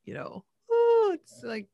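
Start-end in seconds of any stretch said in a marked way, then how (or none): 0.7-1.1 high, loud "ooh"; sudden high squeal of recognition; delighted ooh. Retold or imitated. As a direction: let out a big, high-pitched ooh. Reacting to a familiar accent.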